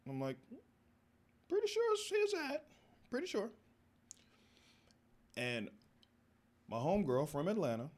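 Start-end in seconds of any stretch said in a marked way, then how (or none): 1.5-3.5 very high, louder falsetto squeak; nervous squeak. Retold or imitated. Suspect squeaking an answer to the guard, nervous falsetto.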